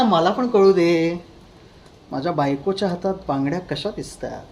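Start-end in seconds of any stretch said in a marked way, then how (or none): 2.1-4.4 soft menacing whisper-like question. Acted.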